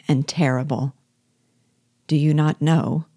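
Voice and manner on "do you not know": ordinary delivery, nothing marked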